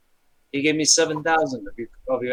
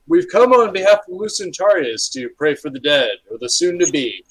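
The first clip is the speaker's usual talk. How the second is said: priest's announcement; 4 semitones higher, 6 dB louder than usual. Acted.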